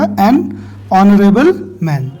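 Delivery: "एन" stressed before "honourable", the whole phrase said slowly; slow model answer. Shown as a demonstration. Teacher stresses the article for students, slow and clear. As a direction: lean on "एन", then "honourable man" slowly. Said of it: "तो यहाँ पर क्या हो जाएगा".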